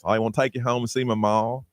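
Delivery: put-on welcoming North Carolina Southern belle accent; female Southern accent. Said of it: "female Southern accent".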